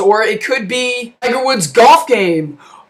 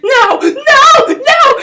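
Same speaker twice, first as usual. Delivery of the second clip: very high, wailed refusals; put-on panicked screeching; mock terrified shriek; 13 semitones higher, much slower than usual. Girl refusing a burning man's hug, high mock shriek.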